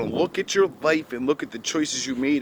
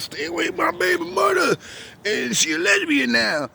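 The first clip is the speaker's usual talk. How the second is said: much higher, mock protective voice; hammed-up warning to stay away; squeaky mock threat about the baby mother; angry man. Retold argument over baby mother.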